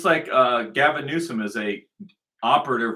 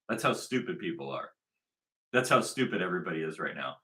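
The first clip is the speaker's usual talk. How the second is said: ordinary talk, nothing marked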